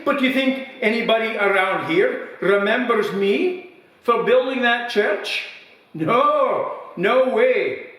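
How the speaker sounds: put-on old villager's voice high and loud, wounded pride; indignant old man. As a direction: huff at the praise like a bitter old builder. Old man in pub joke.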